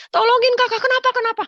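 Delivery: high, loud cry for help; panicked call. Retold or imitated. Wife calls her sister for help, high and loud in panic. Husband collapsing, calling sister.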